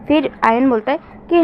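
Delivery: plain reading voice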